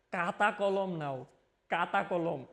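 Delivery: teacher imitates a colleague's dialect, saying কাতা with a hard k; regional accent, খ as ক. শিক্ষকের আঞ্চলিক উচ্চারণ, কাতা. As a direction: say কাতা with a plain unaspirated k, not খাতা. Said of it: "খাতা কলম রে বলে কাতা কলম".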